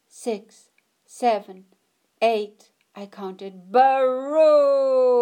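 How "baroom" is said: loud, high booming thunder noise; big thunder boom. A narrator's reading. Thunder sound effect in story.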